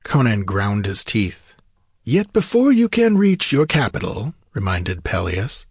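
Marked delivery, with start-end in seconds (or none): 2.0-4.3 high-pitched, theatrical wizard's caution; cautioning sorcerer. Acted. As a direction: remind the king, pitch lifted well up. Narrator voices Peleus reminding.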